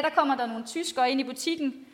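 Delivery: ordinary talk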